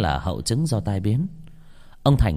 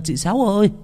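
high, louder call of a friend's name, falling at the end; 11 semitones higher, 4 dB louder than usual. Acted. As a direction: call out to her friend in relief. Old woman calls friend's name.

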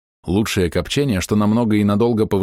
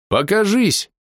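high-pitched calling out; raised, insistent command; shouted demand; 12 semitones higher, slower than usual. A narrator's reading. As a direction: call out the order, pitch raised. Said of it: "крикнул я ещё раз".

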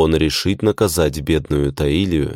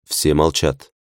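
plain reading voice

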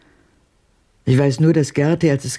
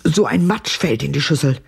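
normal talking voice

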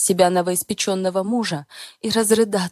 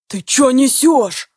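loud slow male growl; growling roar; 6 semitones higher, 10 dB louder, slower than usual. Acted. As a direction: roar it in his face. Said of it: "от мужского рыка".

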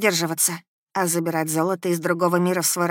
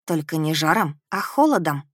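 ordinary delivery, nothing marked

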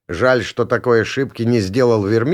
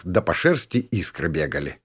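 ordinary delivery

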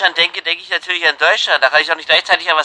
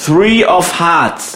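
slow; much slower than usual. Pretend. Slowly corrects band name.

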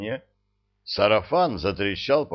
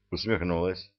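ordinary delivery

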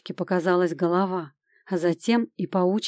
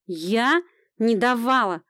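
put-on higher-pitched indignant old woman; indignant denial; 4 semitones higher, slower than usual. A narrator's reading. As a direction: protest as if wrongly accused. Old woman indignantly denies giving gun.

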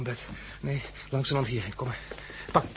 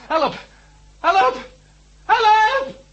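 shrieking high and loud, each cry higher; screaming for help; 10 dB louder, much slower than usual. Acted. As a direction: scream for your life.

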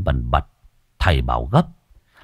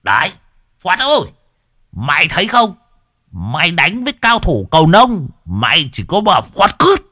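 bellowing; 9 semitones higher than usual. A narrator's reading. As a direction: crow it at the top of the voice. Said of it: "Lão Đồng gào lên". (gào lên đầy hả hê)

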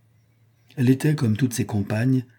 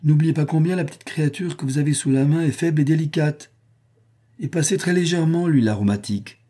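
higher, lightly threatening warning; menacing; 7 semitones higher than usual. A narrator's reading. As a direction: caution the slave with a veiled threat. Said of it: "d'une voix menaçante".

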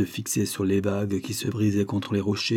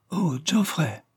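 cry of surprise; 8 semitones higher, slower than usual. A narrator's reading. Miss Dane hearing of three years away, surprised cry. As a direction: exclaim in shocked surprise. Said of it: "son cri de surprise".

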